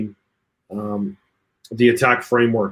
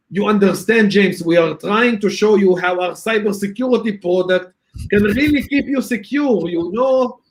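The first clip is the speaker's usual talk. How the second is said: high, lightly mocking Hebrew-accented vendor pitch; 7 semitones higher than usual. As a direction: imitate a vendor in broken English with a Hebrew accent. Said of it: "better with a Hebrew accent".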